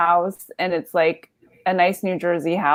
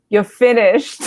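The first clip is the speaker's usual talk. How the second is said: put-on blunt heckler in a New Jersey accent; 3 semitones higher, 4 dB louder than usual. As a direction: toss it off like a Jersey local. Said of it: "Very New Jersey accent".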